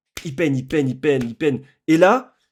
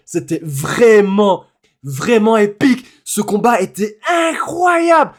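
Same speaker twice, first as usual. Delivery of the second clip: lingering excitement; 4 semitones higher, 6 dB louder, slower than usual. Real, not acted. Summing up the fight.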